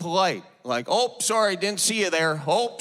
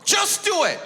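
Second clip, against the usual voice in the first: mock slogan. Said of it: "Nike got its slogan".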